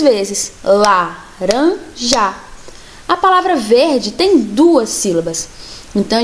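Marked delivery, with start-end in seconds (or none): 0.6-2.3 Laranja spoken slowly, beat by beat; slow syllables. Shown as a demonstration. Teacher showing syllables to kids, slowly. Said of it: "bater um lápis laranja no outro três".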